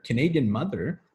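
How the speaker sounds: ordinary talk, nothing marked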